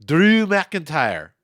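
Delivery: put-on slow, loud ring-announcer Scottish accent; mock Scottish accent, drawn out and booming. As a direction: roll it out like a hammy Scottish announcer. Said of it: "That is my Scottish accent".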